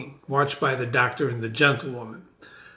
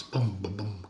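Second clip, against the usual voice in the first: drum sound; 5 semitones lower, 11 dB quieter than usual. Shown as a demonstration.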